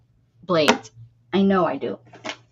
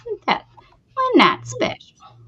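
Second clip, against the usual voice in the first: church lady impression; 12 semitones higher, 3 dB louder than usual. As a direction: ask it like a prim scandalized church lady. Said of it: "The church lady".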